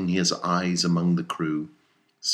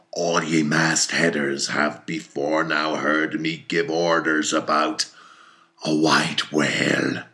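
louder, slower, commanding; stern captain's address; 4 dB louder, slower than usual. A narrator's reading. Captain solemnly addresses all the mastheaders. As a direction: hold the deck like an old captain.

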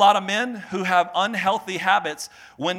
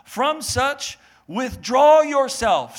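high, slow and weighty on withdraw yourself; emphatic scripture; 6 semitones higher, 3 dB louder, much slower than usual. Acted. Preacher proclaiming the verse.

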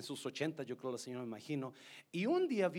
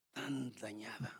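sorrowful whisper; 6 semitones lower, 6 dB quieter, slower than usual. Real, not acted. Lamenting the broken family.

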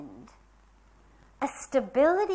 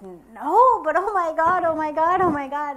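louder, higher cries of oh my God; pleading, overcome, retold with some force; distressed wailing; 5 semitones higher, 8 dB louder than usual. Answering her teacher, a loud retold distressed cry.

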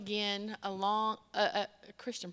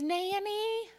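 put-on high, drawn-out child's call; little girl; 10 semitones higher than usual. Eight-year-old calling her grandmother, high child voice.

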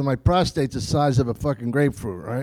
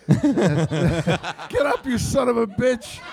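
shouted, pitched up, slower; mock yell; 6 semitones higher, much slower than usual. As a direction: bark it out in mock anger. Retold yell at himself.

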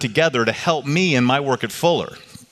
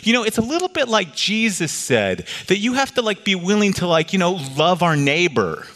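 entrepreneur; 7 semitones higher than usual. Retold or imitated. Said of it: "One of them looks at me and goes".

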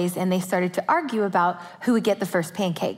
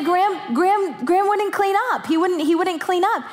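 put-on high, rushed little-boy tattle; high-pitched whiny kid voice; frantic tattling kid; 8 semitones higher than usual. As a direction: squeal it like a boy blaming his brother. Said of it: "And he'll say".

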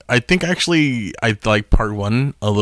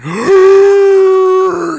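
war cry; 9 dB louder than usual. Retold or imitated. Statue comes alive, war cry.

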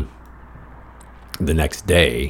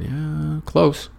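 ironic agreement; 11 semitones higher than usual. Retold or imitated. Ironic reply, high pitch.

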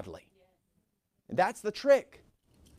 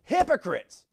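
higher, louder accusing cry; Jesus calling them out, raised voice; yelling; 5 semitones higher, 4 dB louder, slower than usual. Retold or imitated. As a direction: yell it at the Pharisees. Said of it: "He's yelling it".

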